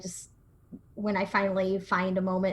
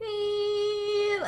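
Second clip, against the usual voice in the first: high, sustained thin squeal; ringing in ears; 13 semitones higher than usual. Retold or imitated. Player imitating gunshot ringing in her ears, high whine. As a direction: imitate tinnitus with one held high tone. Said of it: "I'm still hear the ringing".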